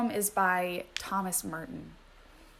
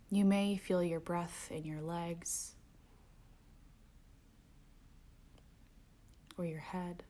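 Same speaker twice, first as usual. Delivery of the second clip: softer meditation voice sinking to whispers; guided meditation; 7 dB quieter than usual. Acted. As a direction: guide the breath, letting phrases fade to a whisper. Returns to guided breathing.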